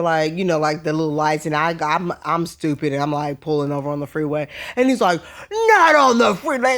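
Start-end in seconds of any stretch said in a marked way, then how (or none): 5.5-6.8 mock bullhorn cop. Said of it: "that bullhorn".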